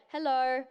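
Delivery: "Hello" with an R-coloured final O; Australian O. Shown as a demonstration. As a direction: end "Hello" on a slight R sound. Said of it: "like of an R".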